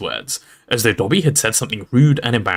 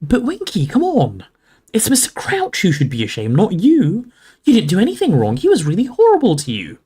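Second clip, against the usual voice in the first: put-on higher-pitched pleading girl; earnest protest; 9 semitones higher than usual. A narrator's reading. Hermione argues with the elf, earnest protest. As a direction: urge her, pitch leaping up.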